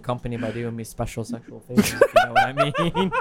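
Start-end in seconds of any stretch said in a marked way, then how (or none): 1.9-3.1 high squeaky laugh through words. Pretend. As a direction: giggle out the innuendo. Sleazy joke, squeaky laugh.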